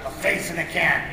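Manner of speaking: loud, gruff growl that builds to a shout; bully the kids along with bluster; blustery cartoon dad. Father inspecting the can and announcing lunch, booming.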